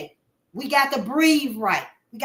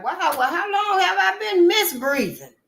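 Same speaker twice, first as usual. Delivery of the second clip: surprised realization; 8 semitones higher than usual. Retold or imitated. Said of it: "And so I'm like".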